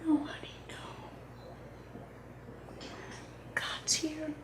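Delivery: soft comforting whisper. Acted. Parent comforting distraught child.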